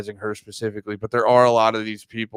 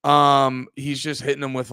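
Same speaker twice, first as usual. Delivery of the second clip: ordinary talk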